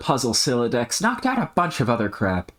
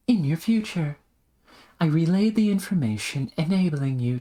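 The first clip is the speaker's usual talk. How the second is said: genteel schemer; 8 semitones higher than usual. Acted. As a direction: explain the plan with airy, formal poise. Plays the genteel character in dialogue.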